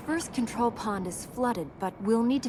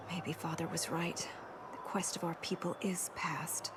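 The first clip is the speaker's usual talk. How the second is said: soft, breathy resignation; hushed, defeated; despairing whisper; 3 semitones lower, 7 dB quieter than usual. Real, not acted. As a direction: let it drop to a whisper. Despair over father's words.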